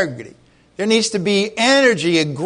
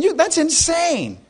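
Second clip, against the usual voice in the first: incredulous inner voice; 11 semitones higher, slower than usual. Retold or imitated. Inner reaction to harnessed kids.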